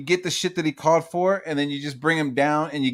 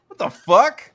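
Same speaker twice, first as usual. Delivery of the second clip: shocked; 7 semitones higher, 7 dB louder, slower than usual. Retold or imitated. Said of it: "in my head, I was like".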